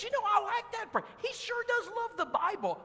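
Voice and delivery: put-on high, sweet older lady voice; preacher voicing the visitor Ellen, high and sweet. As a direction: play her as a sweet lady.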